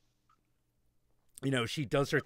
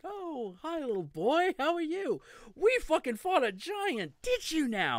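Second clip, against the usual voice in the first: put-on much higher, cooing voice for a little boy; sugary sweet to a child. Witch sweetly greeting Kratos' son, much higher cooing voice. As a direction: coo at him like a doting aunt. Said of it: "She's like".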